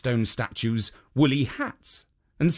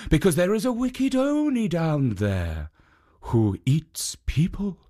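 a lightly higher, gentle statue voice; lightly voiced kind guardian; kindly statue; 4 semitones higher than usual. A narrator's reading. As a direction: warn her gently, a touch higher. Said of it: "And the Jitso said".

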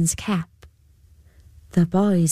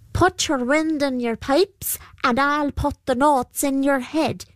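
higher, sly fairy creature; shifting trickster pooka; 7 semitones higher than usual. A narrator's reading. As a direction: promise it like a trickster. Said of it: "replied the Púca".

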